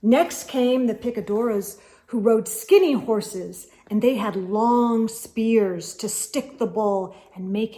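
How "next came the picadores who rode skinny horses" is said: usual speaking voice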